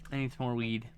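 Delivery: normal talking voice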